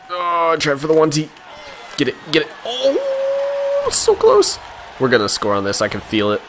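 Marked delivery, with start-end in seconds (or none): 0.1-2.5 higher-pitched, urgent call; excited near-miss cry. Real, not acted. Gamer going for a one-timer, excited cry. 2.6-4.6 very high, drawn-out "oh"; agonized near-miss cry. Real, not acted. Gamer just missing a goal, high agonized cry.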